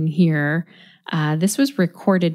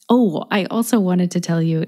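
ordinary talk

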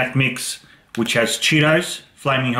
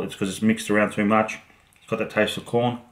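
chewing while talking; mouth partly full; 5 dB quieter, faster than usual. Real, not acted. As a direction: between bites of snack. Seen 1: Holds snack at lips, chewing between bites while speaking.

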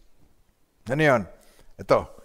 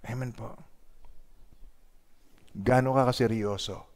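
ordinary talk

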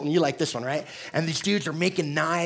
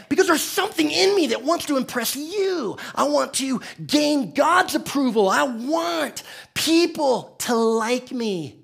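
climbs high and loud, pleading to be liked; preaching crescendo; 10 semitones higher, 6 dB louder than usual. Real, not acted. Pastor to church, crying out for approval in a high, loud, stretched voice. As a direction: preach it out higher and louder, drawn out.

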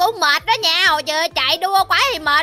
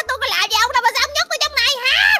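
high-pitched wail of protest; pleading protest; 7 semitones higher, faster than usual. Pretend. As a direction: plead to be let out of the trap. Van xin khi bị nhốt. (van nài, giọng cao)

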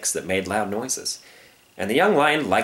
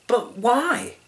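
very high, innocent question; small-voiced, puzzled plea; plaintive young lion; 13 semitones higher than usual. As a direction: ask like a bewildered cub.

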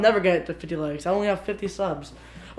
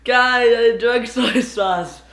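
loud slow shout; yelling; 6 dB louder, much slower than usual. Real, not acted. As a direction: yell it out in shock. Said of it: "I yelled, I made you scared".

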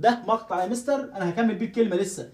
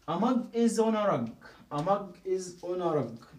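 slow and drawn out; slow model reading; 4 dB quieter, much slower than usual. Shown as a demonstration. Teacher modelling the sentence slowly for students. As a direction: read it out word by word. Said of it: "teacher models sentence slowly".

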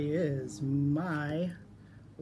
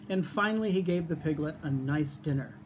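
ordinary delivery

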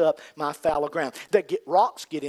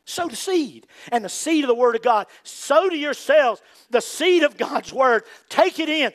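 higher and louder sermon exhortation; preaching at full force; 8 semitones higher, 6 dB louder than usual. Acted. Preacher urging listeners to take in God's Word, loud and raised.

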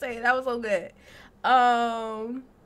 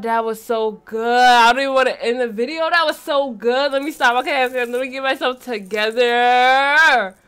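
ordinary talk

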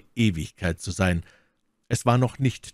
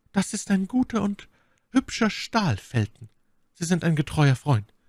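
much higher, admiring praise; lightly voiced lady praising the blade; flattering; 11 semitones higher than usual. A narrator's reading. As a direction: flatter the young officer.

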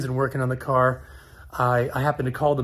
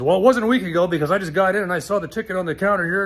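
fast, higher, louder irritated snap; imitating curt owner; 4 semitones higher, 4 dB louder, faster than usual. Shop owner cutting off a customer, fast and irritated. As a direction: rattle it off like a cranky boss.

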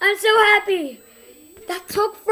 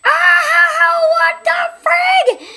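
high, loud slow yell; real fright yell; startled shout; 11 semitones higher, 6 dB louder, much slower than usual. Real, not acted. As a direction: scream it out in shock. Jump scare.

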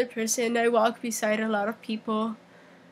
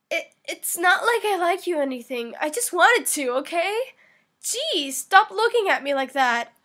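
higher-pitched tsundere protest; flustered anime tsundere denial; 5 semitones higher than usual. Acted. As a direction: play the defensive tsundere, pitch raised. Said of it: "tsundere voice meme".

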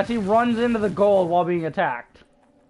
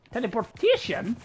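ordinary delivery

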